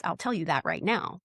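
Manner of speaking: ordinary talk, nothing marked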